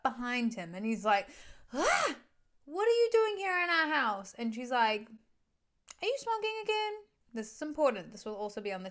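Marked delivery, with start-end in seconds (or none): 1.7-4.3 high-pitched mock fright; startled. Husband caught by his wife on the phone, startled. 6.0-6.9 very high mock wife voice; suspicious questioning. Wife catching her husband on the phone, suspicious.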